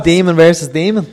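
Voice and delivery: loud, high hype shout; excited shout. Real, not acted. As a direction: yell it like a fan at ringside. Hyped wrestling fans calling out.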